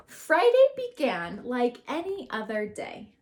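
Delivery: normal talking voice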